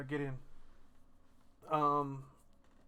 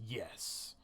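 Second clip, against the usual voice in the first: soft, low-key fierce yes; quiet intensity; 3 semitones lower, 6 dB quieter than usual. Acted. Mother answering her child with fire in her eyes, quiet intensity. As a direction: say it softly but with resolve. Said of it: "looks at you with fire in her eyes".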